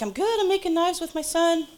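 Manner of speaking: high-pitched, cheery imitation of a friend; chirpy quoted reply. Acting out friend's upbeat phone reply.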